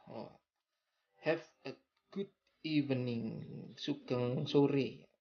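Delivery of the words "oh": normal talking voice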